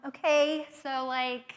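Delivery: usual speaking voice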